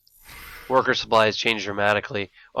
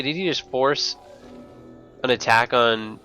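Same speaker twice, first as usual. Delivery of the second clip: normal talking voice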